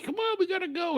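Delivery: put-on high, urgent girl's voice; urgent Melody. Retelling Melody waking the pilot, high urgent voice. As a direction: hurry him, pitched well up. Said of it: "And Melody's like".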